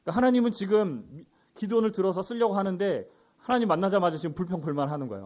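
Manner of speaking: normal talking voice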